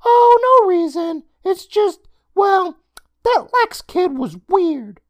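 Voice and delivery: put-on high, loud boyish voice, stalling; evasive boy. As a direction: make up an excuse on the spot, kid voice. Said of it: "David thought quickly and replied".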